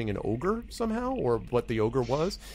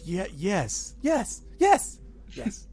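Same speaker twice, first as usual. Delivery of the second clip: a goblin getting excited over "Yes"; goblin repeating a word; 8 semitones higher, much slower than usual. Acted. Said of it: "testing out the word".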